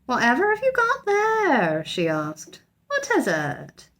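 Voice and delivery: put-on high, alarmed old housekeeper; startled housekeeper asking what it is. A narrator's reading. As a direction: recoil at the strange creature. Mrs Bird startled by bear.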